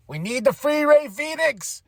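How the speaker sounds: high, drawn-out fan plea; excited plea. Real, not acted. Wrestling fan wanting Rey Fenix here too, excited plea. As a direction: stretch it out, pitched well up. Fan's plea after happy debut.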